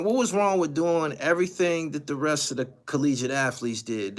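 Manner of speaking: ordinary talk, nothing marked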